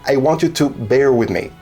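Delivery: usual speaking voice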